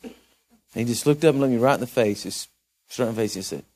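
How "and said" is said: usual speaking voice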